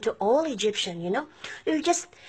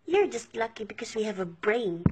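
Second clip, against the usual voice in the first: ordinary talk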